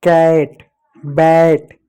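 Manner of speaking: ordinary talk, nothing marked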